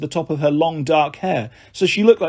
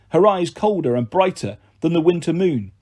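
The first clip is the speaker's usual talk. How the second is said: ordinary delivery, nothing marked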